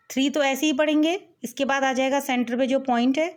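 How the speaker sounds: usual speaking voice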